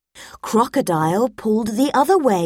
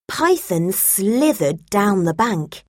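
ordinary delivery

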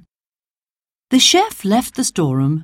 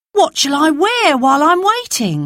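a hint of a worried boy; Dan asking; 10 semitones higher than usual. Dan asking Jane what to wear, higher boyish question.

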